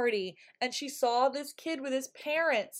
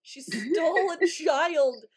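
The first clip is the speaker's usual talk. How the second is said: slow, mock-crying reveal, drawn out and louder; mock wail; 5 semitones higher, 5 dB louder, much slower than usual. Podcaster telling cohost the shocking twist, mock crying. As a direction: wail the words like a fake tragedy. Dramatic reveal of stolen child.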